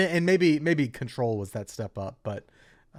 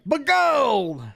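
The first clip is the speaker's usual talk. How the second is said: put-on old prospector; 13 semitones higher, 6 dB louder than usual. Shown as a demonstration. Playing along in prospector voice.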